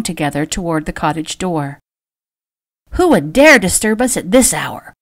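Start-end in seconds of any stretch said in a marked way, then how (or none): 2.9-4.9 a lightly voiced cross old aunt, complaining. A narrator's reading. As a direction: grumble it out as the woken aunt. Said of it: "Aunt Eula Holt complained".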